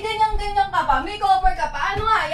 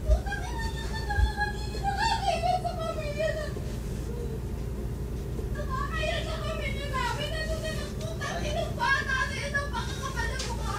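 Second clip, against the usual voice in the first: high, softer whimpering cry; quiet crying; 6 semitones higher, 10 dB quieter than usual. Acted. Sister repeating her shame more softly, quiet whimpering cry. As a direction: weep it under the breath.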